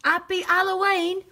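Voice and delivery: put-on high, loud, drawn-out toddler call; slow, shouted little-kid imitation; imitating three-year-old child with accent. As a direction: mimic a small child putting on an accent. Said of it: "I hear my child at three walking away going".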